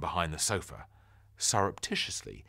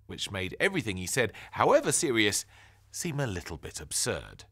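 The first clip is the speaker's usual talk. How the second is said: plain reading voice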